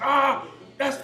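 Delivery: high, loud triumphant holler; imitated yell. Ex-player mimicking a lifter cussing his teammate out, yelling. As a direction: shout like a lifter who just racked it. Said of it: "starts yelling and cussing Porter out".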